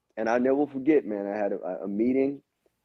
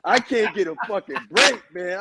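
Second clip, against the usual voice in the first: exasperated laugh, louder; 5 semitones higher, 3 dB louder than usual. Real, not acted. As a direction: laugh in disbelief at your luck. Laughing at his own bad luck.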